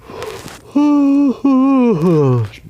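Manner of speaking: a long, high, loud yawn trailing down; tired drawn-out yawn; big real yawn. Real, not acted. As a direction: let a real yawn take over. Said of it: "Sorry for my yawning".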